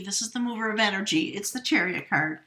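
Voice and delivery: ordinary talk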